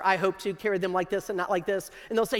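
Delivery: ordinary delivery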